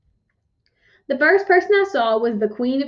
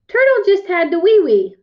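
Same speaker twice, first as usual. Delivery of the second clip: higher, louder mom voice; raised, singsong mom voice; mom explaining; 7 semitones higher, 6 dB louder than usual. A narrator's reading. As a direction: explain brightly to an upset child. Mom explaining to fallen girl.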